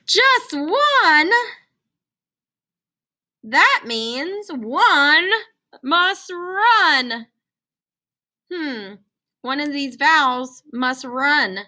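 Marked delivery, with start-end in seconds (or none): 0.1-1.3 high, loud surprised question; mock surprise. Shown as a demonstration. 3.4-7.3 high, loud and very slow; dramatic announcement. Shown as a demonstration.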